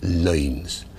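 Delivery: lines said as loins with the oy vowel; loins, oy vowel, slow; Shakespearean pronunciation. Shown as a demonstration. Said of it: "lines was pronounced loins".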